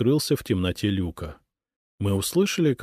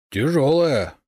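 strained, raised complaint; loud, higher moan about the effort; complaining; 7 semitones higher, 5 dB louder, slower than usual. A narrator's reading. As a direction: grumble under a heavy load. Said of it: "пожаловался он".

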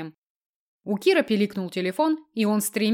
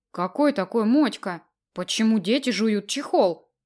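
plain reading voice

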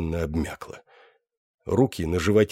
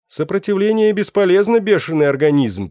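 голос переводчика; 10 semitones higher, 6 dB louder than usual. Acted. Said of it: "произнёс словарь".

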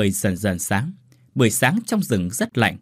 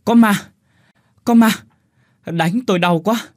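much higher and louder, yelling in panic; frightened shout; 10 semitones higher, 6 dB louder than usual. A narrator's reading. Tâm wakes up and screams in terror at the ghost. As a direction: cry out that there's a ghost. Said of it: "Tâm vừa mở mắt thì la lên".

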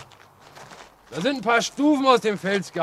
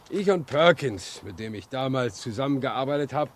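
ordinary delivery

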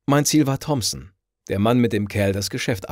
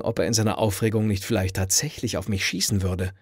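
plain reading voice

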